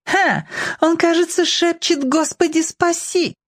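put-on very high, taunting girl's voice; sneering girl. A narrator's reading. Skinny girl mocking the boy on the roof, snidely. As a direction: jeer in a high-pitched child's voice. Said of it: "съязвила внизу тощая девчонка".

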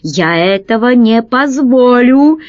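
ordinary delivery

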